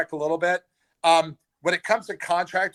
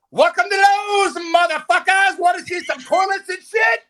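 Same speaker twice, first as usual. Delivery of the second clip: unhinged greeting shout; 5 dB louder than usual. Retold or imitated. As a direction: scream the greeting at the customers like a maniac. Yelling at customers, story punchline.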